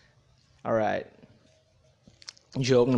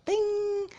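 imitated high bell ding; high ding sound. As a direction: sing a bell's ding.

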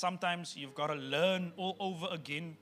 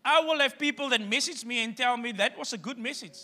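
raised, loud preacher's voice; preaching at full force; 7 semitones higher, 7 dB louder than usual. Acted. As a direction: drive the point home to the congregation.

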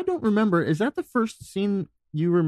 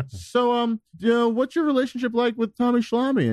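raised pitch, polite questioning; high interviewer voice; 9 semitones higher than usual. Pretend. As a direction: play a chirpy fake-innocent interviewer. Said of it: "Or I'll just be like".

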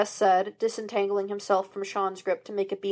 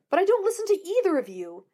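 protesting; 10 semitones higher, faster than usual. A narrator's reading. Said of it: "Sean protested".

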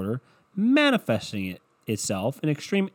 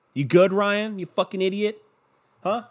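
higher, mocking self-scold; mock berating; 7 semitones higher than usual. Talking to himself, higher mock berating. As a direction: scold yourself like a fed-up friend.

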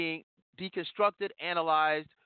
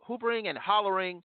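ordinary talk, nothing marked

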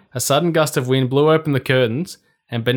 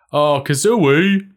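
muffled under a pillow, slow; 3 semitones higher, 4 dB louder, slower than usual. Acted. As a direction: speak through a pillow over the head. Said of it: "Come his muffled voice".

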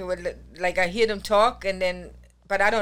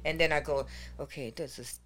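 counting in German, trailing off quieter; counting under breath; 7 semitones lower, 7 dB quieter than usual. Shown as a demonstration. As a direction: count to yourself, fading to a murmur. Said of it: "every time I count".

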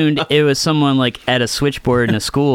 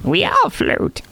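creepy clown voice; 7 semitones higher, slower than usual. Acted. Quoting It's clown.

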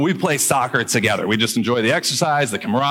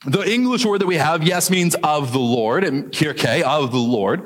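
ordinary delivery

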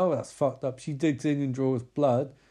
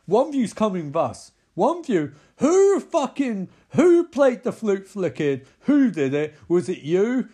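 loud, higher, angry barked questions; barking; 6 semitones higher, 7 dB louder than usual. Retold or imitated. Tribe elder barking at Jocelyn, loud angry interrogation. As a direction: demand who played the flute. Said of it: "He barks at Jocelyn".

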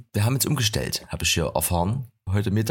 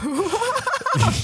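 lautes Auflachen. Real, not acted. Lacht über Eriks Spruch.

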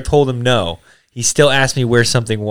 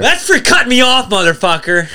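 gleeful taunt; 10 semitones higher, 3 dB louder than usual. Real, not acted. Payback taunt at friend.

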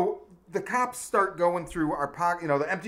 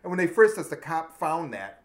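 usual speaking voice

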